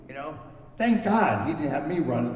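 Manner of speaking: ordinary talk, nothing marked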